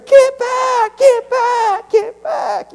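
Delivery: very high, frantic imitated scream of get back; imitating mother screaming. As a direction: screech it like a terrified mother. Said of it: "And Mama screaming".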